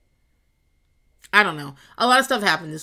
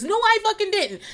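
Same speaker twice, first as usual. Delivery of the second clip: indignant denial; 13 semitones higher, 3 dB louder than usual. Pretend. Arguing back at imagined friend.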